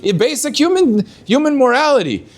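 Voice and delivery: lightly voiced scolding of a hypocrite; incredulous challenge. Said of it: "And they would ask him".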